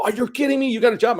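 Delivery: high, thrilled disbelief; excited mom. Retold or imitated. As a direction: voice mom lighting up with joy. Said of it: "lit me up and just go".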